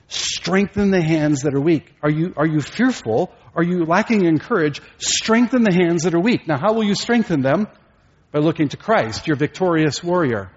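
urgent preaching, climbing in pitch; rally them, building as you go; exhorting preacher. Real, not acted. Preacher rallies the fearful and weak, urgently exhorting.

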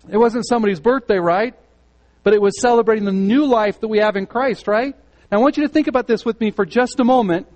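high, loud, fast preaching push; fervent preaching. Acted. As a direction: drive the point home from the pulpit. Preaching at full force.

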